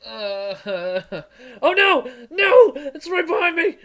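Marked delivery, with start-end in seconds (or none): 1.6-3.8 high loud panicked yelling; scared horror game streamer. Real, not acted. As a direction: shriek it, half laughing. Monster right behind, panic.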